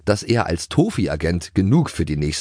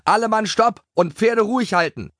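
high, urgent order to the group; shouted command; 3 dB louder than usual. A narrator's reading. Dennis halts his riding group, shouted command. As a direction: call the riders to a halt. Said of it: "rief Dennis und hob eine Hand".

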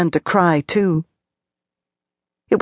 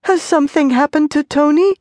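fearful question; 10 semitones higher than usual. A narrator's reading. Frightened wife asks.

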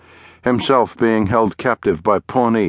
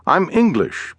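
high, interrupting English gentleman; English accent; 8 semitones higher than usual. A narrator's reading. Englishman interrupting to explain his accent, in high-pitched English tones. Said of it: "Bentley said, interrupting".

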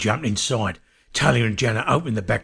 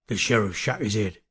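ordinary delivery

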